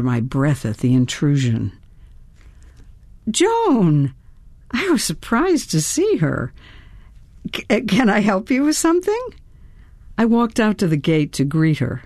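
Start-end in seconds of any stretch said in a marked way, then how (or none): 3.3-4.1 high startled call of her name; surprised. A narrator's reading. Surprised greeting. 7.5-9.3 quick, high, stumbling question; flustered. A narrator's reading. Flustered, stuttering greeting.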